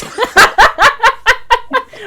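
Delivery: big high-pitched burst of laughter; loud high laugh. Real, not acted. Host cracking up at friend's protest, loud high laugh.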